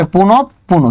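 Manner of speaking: পুনঃ পুনঃ as "punop-puno"; correct pronunciation. Shown as a demonstration. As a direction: close the first পুনঃ on a doubled p. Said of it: "উচ্চারণ কি হবে".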